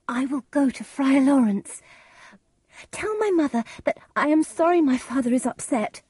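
put-on high-pitched young bride; high, distressed daughter; Juliet. A narrator's reading. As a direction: speak high, still upset. Juliet gives nurse a message.

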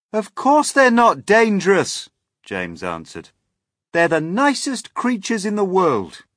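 high, loud reassurance; earnest calling out. Acted. James defending his friends to the police, earnest call.

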